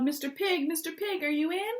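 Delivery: high, sing-song call at the door. Acted. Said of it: "I called".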